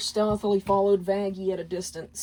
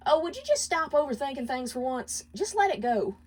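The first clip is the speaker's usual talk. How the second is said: higher voice, brushing him off; dismissive; 7 semitones higher than usual. Acted. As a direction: wave the argument away. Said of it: "shut down the argument with gaslighting".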